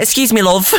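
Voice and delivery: cute little mock British voice; little British accent. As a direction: imitate a pop star's British accent. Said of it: "he's, like, little British accent".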